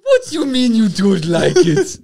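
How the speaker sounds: high, loud, indignant mock Zimbabwean accent. Co-host scolding his reluctant partner, mock Zimbabwean accent. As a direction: protest in a hammed-up Zimbabwean accent. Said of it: "I'm not doing the accent".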